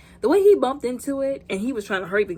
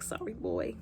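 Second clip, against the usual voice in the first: soft apology; 13 semitones lower, 11 dB quieter than usual. Retold or imitated. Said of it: "He was like".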